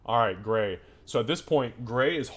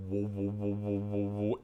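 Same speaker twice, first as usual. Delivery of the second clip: pulsing, echoing "woo" imitation; resonating drum; 4 dB quieter than usual. Game master imitates a drum resonating through the chamber. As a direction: let the sound throb on evenly like reverberation. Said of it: "drum resonates throughout the whole chamber".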